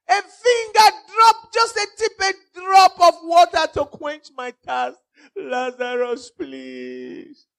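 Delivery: high, wailing, drawn-out begging; shouted plea fading. Acted. Rich man begs Lazarus for water, wailing shout that fades. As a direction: cry out slowly at full voice, sinking to a weak plea. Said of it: "He shouted".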